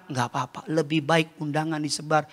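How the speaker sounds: low, soft consolation; God's calm reply. Acted. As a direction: reassure quietly from low in the voice. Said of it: "Tuhan bilang". (Tuhan menghibur dengan suara rendah dan tenang)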